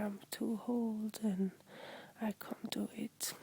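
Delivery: normal talking voice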